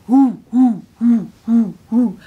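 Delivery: loud monkey hoots; sneering baboon hoot. Shown as a demonstration. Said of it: "Everybody hold up baboon eyes".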